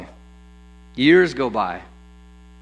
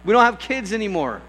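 high, loud tirade as exasperated Sarah; mock indignant yell; 9 semitones higher, 5 dB louder than usual. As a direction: yell it as if chasing off visitors. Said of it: "run out and yell at him".

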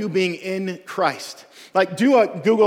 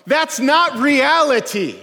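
high, loud, emphatic refusal; preacher's raised-voice correction; gospel's firm rebuttal; 8 semitones higher, 5 dB louder, much slower than usual. Acted. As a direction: cut in with a drawn-out, forceful denial. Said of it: "the gospel comes along and goes".

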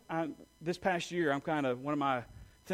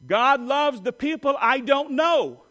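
slow, loud, higher and glad; joyful call; 5 semitones higher, 8 dB louder, slower than usual. Shown as a demonstration. Preacher calling the line for the church to repeat. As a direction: lead it out with warmth and joy. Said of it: "You repeat after me".

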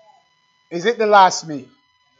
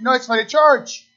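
ordinary talk

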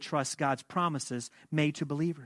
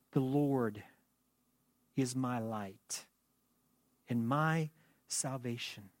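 read slowly; slower than usual. Shown as a demonstration. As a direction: let each phrase sink in slowly. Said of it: "I want to read this verse again slowly".

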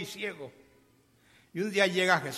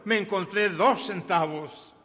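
loud, slow, insistent announcement; acted-out boy bursting with excitement; excited little boy; 3 semitones higher, 6 dB louder than usual. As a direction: stretch out the big news for mom. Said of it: "pero excitado, excitado, excitado".

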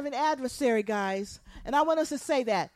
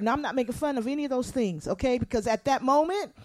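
ordinary talk, nothing marked

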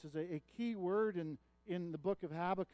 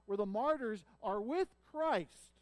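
ordinary talk, nothing marked

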